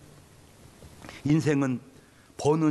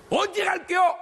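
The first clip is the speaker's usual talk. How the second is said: furious demand. Retold or imitated. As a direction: demand when the ghost will finally leave. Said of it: "충청도 귀신들은요".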